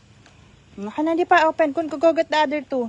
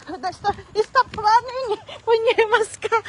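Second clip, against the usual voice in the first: high, slow call to stop; 7 semitones higher, slower than usual. Real, not acted. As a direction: call him back, stretched out. Aunt stops running toddler. (tawag na mataas at mabagal)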